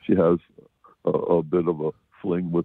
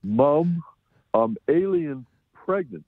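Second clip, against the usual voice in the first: higher and slow, a mock daughter's confession; lightly acted movie-title quote; acted film line; 6 semitones higher, much slower than usual. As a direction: play the daughter blurting it out to mom.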